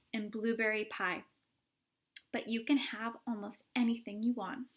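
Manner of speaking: plain reading voice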